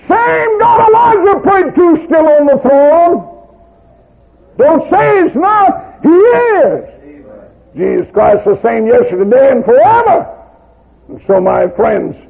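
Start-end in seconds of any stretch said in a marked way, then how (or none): 0.1-10.3 preaching at full force. Acted. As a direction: thunder it out to the congregation. Preacher proclaims God unchanged.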